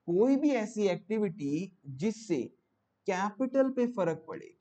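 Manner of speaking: slow, spaced-out definition; slow and easy. Shown as a demonstration. Teacher defining financing activity for students, slow and easy. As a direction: take it phrase by phrase, unhurried. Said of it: "आराम आराम से बोलूं आपको".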